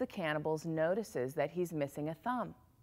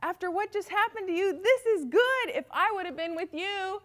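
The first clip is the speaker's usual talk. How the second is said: much higher, building to a loud cry at the end; excited friend; 7 dB louder than usual. Acted. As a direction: insist happily that it was for the best. Friend reassuring the king.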